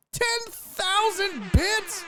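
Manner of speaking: high, slow disbelief; incredulous. Real, not acted. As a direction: stretch it out high, unable to believe it. Disbelief at donation.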